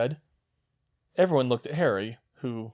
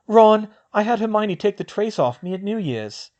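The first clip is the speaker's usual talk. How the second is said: put-on higher young woman's voice, brisk; girl's voice; 10 semitones higher, 7 dB louder than usual. Hermione planning with Luna, in a higher girl's voice. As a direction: voice the girl, a clear step up in pitch.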